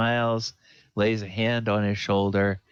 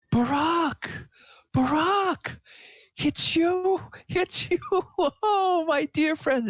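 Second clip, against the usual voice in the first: overjoyed reunion cry. Acted. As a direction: burst out in delight at seeing an old friend again. Throry greets returned friend Barak.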